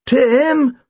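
urgent high call across the field. Acted. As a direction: call his name sharply. Calling urgently to his brother.

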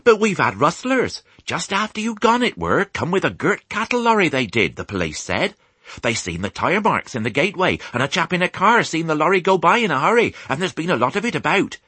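high-pitched rustic chatter, tumbling on; excited farmwife. A narrator's reading. Farmer's wife tells husband about rustlers, excited chatter. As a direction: gush the news high, in country dialect. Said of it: "cried Missus Hoggett".

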